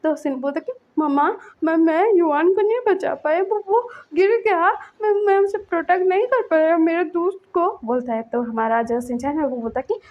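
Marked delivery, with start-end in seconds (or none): 1.0-7.8 put-on high stammering child voice; a boy crying that he failed his friend; child voice, upset and stammering; tearful child.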